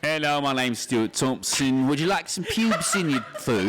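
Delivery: Cockney bartender. Pretend. Comic playing the new Chili's bartender, in exaggerated Cockney. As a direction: play a greasy Cockney bartender. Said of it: "introducing the newest bartender at Chili's".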